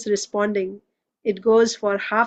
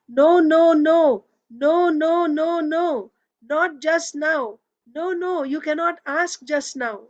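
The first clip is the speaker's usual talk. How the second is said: insistent repeated no; 5 semitones higher, slower than usual. A narrator's reading. Prabhupada silencing a heckler.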